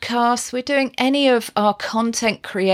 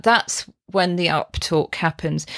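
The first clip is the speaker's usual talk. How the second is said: ordinary talk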